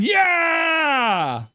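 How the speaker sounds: long, high yell that falls away; cheering yell. Retold or imitated. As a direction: shout it out like a fan, stretched long. Reads David's celebratory chat message.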